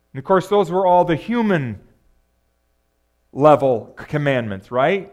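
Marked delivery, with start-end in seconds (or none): none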